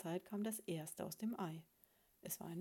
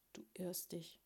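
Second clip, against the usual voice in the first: soft, weary contradiction; feeble; 6 dB quieter than usual. A narrator's reading. Dizzy husband weakly contradicting his wife, feeble. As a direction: say it faintly, all fight gone. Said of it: "sagte er matt".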